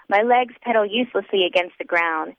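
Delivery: usual speaking voice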